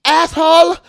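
high, loud comic insult; imitated angry neighbor. Big man insulting the driver, imitated in a loud high voice. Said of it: "if he heard his fucking voice".

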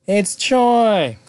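loud, high ta-da announcement; excited big reveal. Real, not acted. Recapper reveals who rang the doorbell, triumphant surprise.

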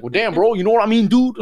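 loud, high, hammed-up Miami accent. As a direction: exaggerate the Miami street talk. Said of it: "you sound like you're from Miami".

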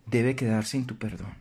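normal talking voice

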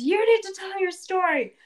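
"You need to tell your story" called out high and loud; booming magic voice. Retold or imitated. Kirian accusing the queen before the court, booming. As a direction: boom it like a magically amplified accusation. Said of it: "makes his voice really loud and booming".

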